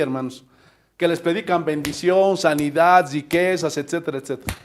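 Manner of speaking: usual speaking voice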